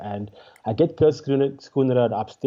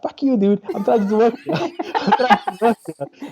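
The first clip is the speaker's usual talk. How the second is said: high-pitched, indignant mock argument; exasperated retort; 10 semitones higher, 3 dB louder than usual. Comic retelling his clash with front-row man, exasperated. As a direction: protest heatedly in a much higher voice. Acted quarrel with a heckling man.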